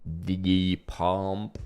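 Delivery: slow, drawn-out mock Austrian accent; Arnold impersonation, slowed down. Shown as a demonstration. Drummer practicing the impression slowly, mock Austrian accent. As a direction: stretch each word in a fake Austrian accent. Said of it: "I'll work it up slowly".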